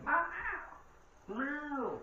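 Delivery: high, drawn-out falling meow; imitated cat call. Showing a cat-summoning trick seen online, imitated meow. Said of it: "If you say this to your cat".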